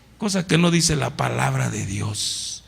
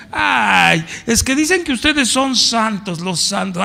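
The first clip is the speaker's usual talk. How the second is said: sing-song mock of a scoffer, pitched up; 5 semitones higher, 4 dB louder than usual. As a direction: sneer it like a neighbour teasing. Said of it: "con mofa me han dicho".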